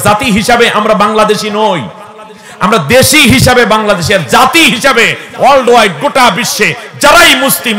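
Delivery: loud, high call-and-response preaching; full-force preaching. Acted. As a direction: rouse the crowd and make them answer back. (উচ্চস্বরে জোরালো ওয়াজ)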